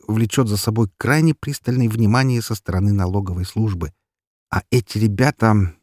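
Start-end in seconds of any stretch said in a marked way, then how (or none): none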